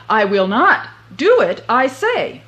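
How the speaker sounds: loud stubborn refusal; defiant yell. Acted. Boots to the king, loud stubborn refusal. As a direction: insist it loudly.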